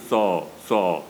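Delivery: so said slowly with the 澳 dropped; so only, no 澳 sound, slow; local Suao pronunciation. Shown as a demonstration. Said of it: "苏澳人讲苏澳不像我们讲苏澳".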